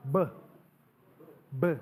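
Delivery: B said as a short "buh"; phonic sound of B. Shown as a demonstration. Pastor teaching phonics, B as a short "buh" sound. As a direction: sound out B as "buh", not "bee". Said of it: "You pronounce it".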